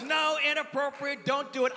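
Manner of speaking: high, mock-stern mother; disapproving scolding. Wife scolds son for the noise, high disapproval. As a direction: shut it down as the appalled mom.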